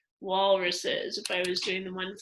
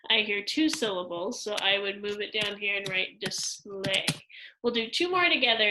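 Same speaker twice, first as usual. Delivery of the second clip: ordinary talk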